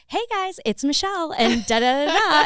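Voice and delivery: chirpy TV presenter on the red carpet; movie surfer voice. Shown as a demonstration. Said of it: "I can't break my movie surfer voice".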